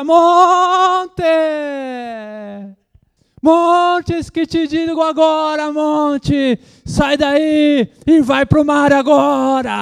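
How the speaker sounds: very high, loud, slow commanding yell; hammed-up authoritative rebuke, high and loud; mock pentecostal shout. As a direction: stomp and shout at the mountain. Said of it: "sapatear diante do monte".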